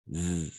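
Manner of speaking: a long voiced Zzz like a bee; buzzing Zzz for the class. Shown as a demonstration. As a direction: hum the Zzz with the throat vibrating. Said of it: "comme une abeille".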